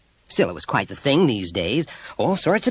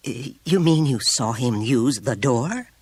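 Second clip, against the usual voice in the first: stammered, slow, higher-pitched question; astonished; 5 semitones higher, 3 dB louder, slower than usual. A narrator's reading. Teacher hearing he used the door, astonished. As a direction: ask it in slow disbelief. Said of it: "Miss Robbins looked astonished".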